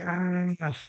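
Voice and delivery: high held "ah"; mock cry held high; imitated cry. Reader mimics the characters reacting, high held mock cry.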